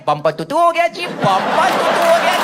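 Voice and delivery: put-on high, loud lisping baby voice; baby talk. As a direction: play dumb in cutesy baby talk. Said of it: "तोतला बोलना शुरू कर दो".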